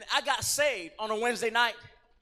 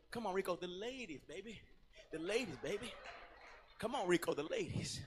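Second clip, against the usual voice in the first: softer, lower teasing lure; sly tempting; 4 semitones lower, 12 dB quieter than usual. Retold or imitated. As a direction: hush it like a temptation. Friends tempting him with ladies.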